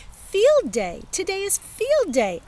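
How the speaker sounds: high, loud happy yell; excited shout. Acted. Kid shouting into her sleeping baby brother's room, loud and high. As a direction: bellow it into the nursery with glee. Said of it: "I shouted to baby Ollie".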